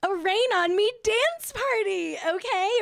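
high-pitched, bouncy hype; hyped announcement. Real, not acted. To viewers ending the show with a dance party, hyped. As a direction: pump up the crowd for a dance party.

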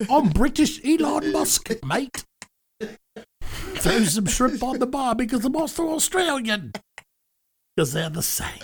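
high, badly faked British-Australian accent; Elon Musk. Host mashing accents as the billionaire, mock British and Australian accent. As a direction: ham up a muddled British-turned-Australian accent. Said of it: "we can't do any accents".

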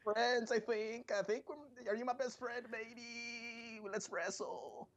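high, gushing fanboy voice; fawning QT impression. Mocking QT thanking Cody, high fawning voice. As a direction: gush over Cody in a high sweet voice. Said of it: "I'm like".

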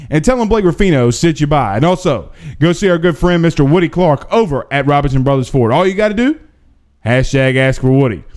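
upbeat sponsor pitch, numbers spelled out clearly; radio ad read. Acted. Host reading a sponsor ad to listeners, upbeat pitch. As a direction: sell the dealerships like a radio spot.

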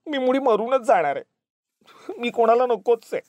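acted high, tearful wail; melodramatic, high-pitched crying voice; sobbing. A narrator's reading. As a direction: wail it through sobs. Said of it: "हमसून हमसून रडतच राहिली".

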